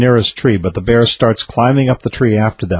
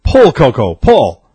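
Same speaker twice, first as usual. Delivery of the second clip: raised, higher commanding call; firm command; 4 semitones higher, 4 dB louder, slower than usual. A narrator's reading. Farmer once more commands his horse, forceful call. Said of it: "Once more the farmer commanded".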